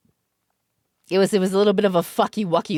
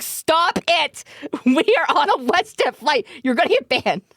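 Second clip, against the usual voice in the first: laughing plea; 8 semitones higher than usual. Retold or imitated. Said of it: "He's like".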